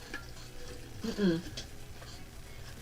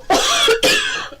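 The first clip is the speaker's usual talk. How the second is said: loud, high choking cough; 6 dB louder than usual. Real, not acted. Streamer choking on own spit mid-read, real cough. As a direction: choke mid-sentence and cough it out. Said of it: "I choked on my own spit".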